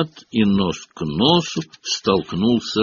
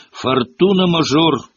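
ordinary delivery, nothing marked

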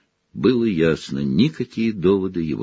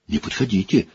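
weak but firm. Acted. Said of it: "Голос его был слаб, но решителен".

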